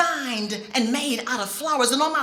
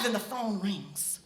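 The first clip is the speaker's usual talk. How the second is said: ordinary delivery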